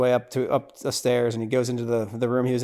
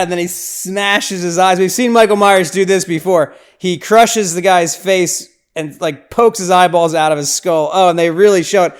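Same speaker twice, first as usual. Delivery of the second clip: louder, higher, aghast; shocked excitement; 5 semitones higher, 8 dB louder than usual. Real, not acted. Podcaster recapping a gory kill, loud and aghast. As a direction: react to the brutal kill live.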